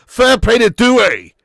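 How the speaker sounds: loud, high, slow shout; emphatic praise. Real, not acted. Commentator praising Doué after his equaliser, emphatic shout. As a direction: call it out big, stretching the words.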